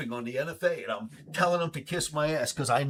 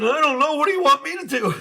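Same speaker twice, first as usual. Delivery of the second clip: laughing; 11 dB louder than usual. Real, not acted. Laughing back at the lawyer.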